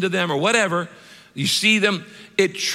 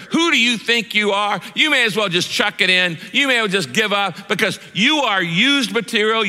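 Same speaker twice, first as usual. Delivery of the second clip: accusing Satan shouting; 5 semitones higher than usual. Retold or imitated. Satan shouting the sinner down after the sin, accusing. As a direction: hammer them down with blame. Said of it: "he starts going".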